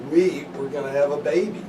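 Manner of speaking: normal talking voice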